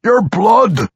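high, loud craving dwarf vampire; Pwent. A narrator's reading. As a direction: blurt out the craving loudly. Vampire craving blood, explains.

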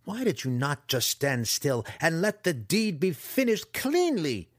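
loud, rising villain roar. A narrator's reading. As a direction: roar it in disgust. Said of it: "the faceless one roared".

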